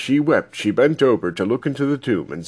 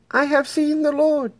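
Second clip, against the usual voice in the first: much higher voice for Mary; Mary's joyful news. Acted. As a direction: voice Mary high, telling the news. Mary brings news to disciples.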